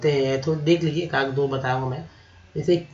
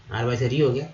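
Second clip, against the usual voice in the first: usual speaking voice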